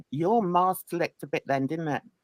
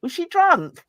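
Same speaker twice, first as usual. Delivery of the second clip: mock outraged question; 8 semitones higher, 4 dB louder than usual. Mocking the accusation.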